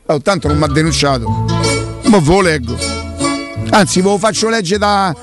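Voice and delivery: normal talking voice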